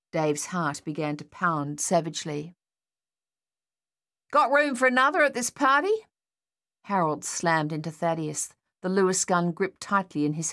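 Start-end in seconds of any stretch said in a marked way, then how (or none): 4.3-6.1 high, lively soldier's call; jaunty greeting. A narrator's reading. Harold barging in beside comrades under fire, jaunty. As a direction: pitch it up, cheeky and lifted at the end.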